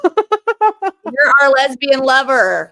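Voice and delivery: drawn-out playful declaration; slow teasing drawl. Pretend. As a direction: stretch it out teasing. Teasing mock announcement.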